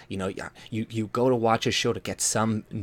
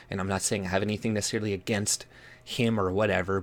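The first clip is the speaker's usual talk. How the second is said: ordinary talk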